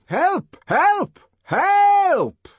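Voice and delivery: building very high cries for help; escalating frantic yells. A narrator's reading. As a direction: call out repeatedly, the last long and loudest. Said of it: "more and more loudly".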